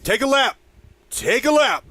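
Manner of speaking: loud, high shout across the field; heckling fan yell. Retold or imitated. Imitating a guy yelling at players, loud high holler. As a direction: bellow it like a fan playing coach. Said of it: "there was a guy yelling".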